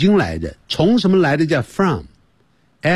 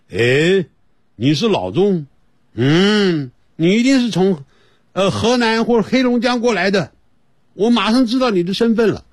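higher-pitched mock detection of an accent; spy-spotter; 7 semitones higher than usual. Listener unmasks the Chinese spy, lightly acted higher-pitched discovery. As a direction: lightly play someone catching out a spy. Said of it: "如果我们讲的这个英文一讲".

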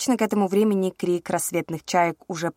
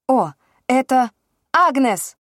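louder, higher burst of relief; excited recall; 7 semitones higher, 7 dB louder, slower than usual. A narrator's reading. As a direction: blurt the name out in relief. Sudden relieved recall of name.

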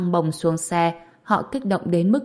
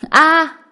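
pained scream; 10 semitones higher, 9 dB louder than usual. Acted. Thug cries out in pain.

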